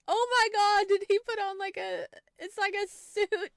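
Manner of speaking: high, loud delighted squeal; excited squeal. Real, not acted. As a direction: gush over the outfit at full volume. Reacting to date's outfit.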